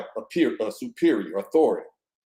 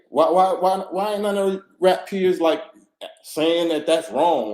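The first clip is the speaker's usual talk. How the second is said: indignant outcry; 5 semitones higher than usual. Real, not acted. Indignant rant about rap peers.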